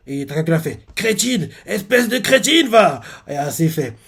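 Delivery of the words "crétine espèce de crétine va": crier «crétine» plus aigu, en traînant; insulte jouée aiguë. Retold or imitated. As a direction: fausse dispute pour tromper le policier. Takakura insulte AAC, dispute jouée. (insulte aiguë et appuyée pour faire croire à une dispute)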